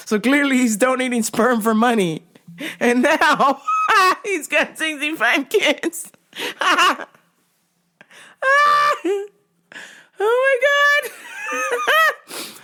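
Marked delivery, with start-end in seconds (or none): 2.8-9.3 high-pitched words broken by laughing; real laughter. Real, not acted. Cracking up at the news. 10.2-12.5 very high squeal through laughter; helpless laughter. Real, not acted.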